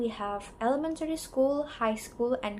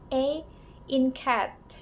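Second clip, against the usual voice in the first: usual speaking voice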